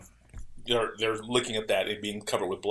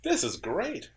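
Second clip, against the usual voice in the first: higher-pitched retold enthusiasm; retold delight; 8 semitones higher, 4 dB quieter, much slower than usual. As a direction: quote your own pleased discovery, pitched up. Retold delight at discovering the movie.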